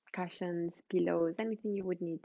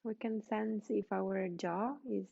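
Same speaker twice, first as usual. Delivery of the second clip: guided meditation; 4 dB quieter than usual. Real, not acted. Yoga teacher settling students lying on the floor, guided meditation. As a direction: guide them gently into rest, leaving space between phrases.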